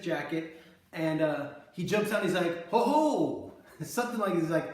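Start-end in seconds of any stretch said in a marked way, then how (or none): 2.7-3.5 hammed-up mugger hollering ho ho, higher and louder; a forced, stagey robber calling out; theatrical mugger. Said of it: "very forced and theatrical".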